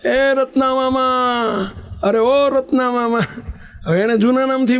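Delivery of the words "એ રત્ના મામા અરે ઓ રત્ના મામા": high-pitched call across the temple yard. Retold or imitated. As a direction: nephew calling his uncle from below the temple spire, high-pitched. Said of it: "માંડી બૂમો પાડવા".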